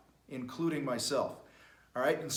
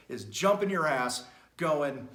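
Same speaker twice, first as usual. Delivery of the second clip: usual speaking voice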